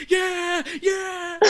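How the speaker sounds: high, laughing whoop; mock jock cheer. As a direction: cheer like a pumped-up teammate. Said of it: "they're like".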